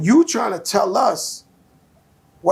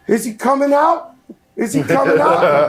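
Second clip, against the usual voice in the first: laughing mimic; 5 semitones higher, 4 dB louder than usual. Voicing waiting attackers.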